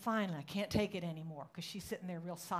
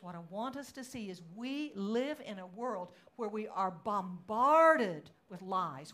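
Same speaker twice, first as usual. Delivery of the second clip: usual speaking voice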